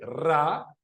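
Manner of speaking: "Ra" with a strong trilled R; rolled double R. Shown as a demonstration. Teacher showing that R here sounds like double R, rolled. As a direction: hold the rolling R at the start of "Ra". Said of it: "suena como doble R".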